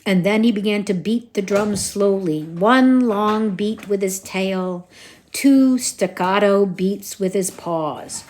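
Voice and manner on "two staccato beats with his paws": ordinary delivery, nothing marked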